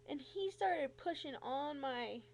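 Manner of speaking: ordinary talk, nothing marked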